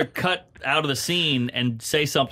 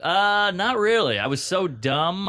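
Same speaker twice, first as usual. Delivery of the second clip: ordinary talk, nothing marked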